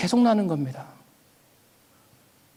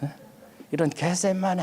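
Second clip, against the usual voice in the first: inward grumbling; 2 semitones lower, 8 dB quieter than usual. Retold or imitated. Preacher replaying a private curse at a junior, inward grumbling. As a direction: grumble it under your breath. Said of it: "아, 속으로".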